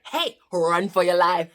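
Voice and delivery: loud urgent warning; alarmed call. Pretend. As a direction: yell a warning to run. Head voicing alarm.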